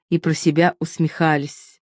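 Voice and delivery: ordinary delivery, nothing marked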